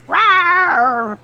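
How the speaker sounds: high, loud roar back at the dragon; huge fiery dragon roar. Retold or imitated. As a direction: roar back even bigger. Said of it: "and Azel's like".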